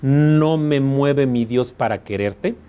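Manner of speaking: slow, measured reading of a verse; poem recital. Shown as a demonstration. Teacher reciting a sonnet to the class, slow and measured. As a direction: recite it slowly, line by line. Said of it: "Si yo digo, por ejemplo".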